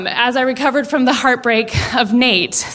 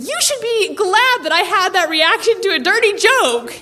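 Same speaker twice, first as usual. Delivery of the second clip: higher-pitched, insistent retold plea; pleading her case; 8 semitones higher than usual. Daughter pleads her case to mom over dinner, indignant. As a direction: argue your case in a raised pitch. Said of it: "I tried to plead my case".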